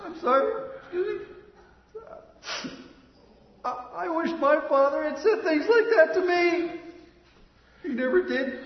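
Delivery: overcome, trying to apologise through tears; crying. Acted. Therapist moved to tears by a father's words, crying. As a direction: voice breaking high, laughing through tears. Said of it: "I'm crying because he said".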